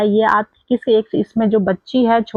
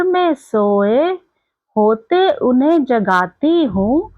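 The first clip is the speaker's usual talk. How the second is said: slow, higher-pitched singsong recital; poem recitation; 4 semitones higher, slower than usual. Acted. Teacher reciting a poem to young pupils, slow lilting recitation. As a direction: recite it slowly in drawn-out, lilting lines.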